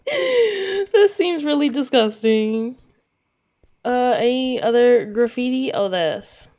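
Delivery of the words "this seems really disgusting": high, slow wail after a laugh; laughing disgust. Real, not acted. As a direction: whine it out, nearly crying with laughter. Laughing at the pizza.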